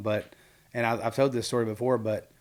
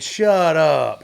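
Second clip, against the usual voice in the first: loud, raised "Shut up"; emphatic mock-incredulous exclamation; joking disbelief; 4 semitones higher, 8 dB louder than usual. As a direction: hit it big, as in "no way". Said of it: "The way I was saying it".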